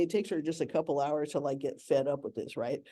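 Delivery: ordinary delivery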